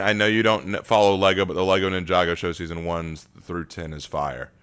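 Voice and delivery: usual speaking voice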